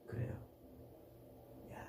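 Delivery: ordinary talk, nothing marked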